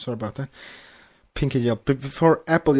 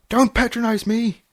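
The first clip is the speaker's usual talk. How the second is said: high, tight snap; wife snapping at her husband; through gritted teeth; 11 semitones higher, 3 dB louder than usual. A narrator's reading. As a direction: bite the words back in pain. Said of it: "through gritted teeth".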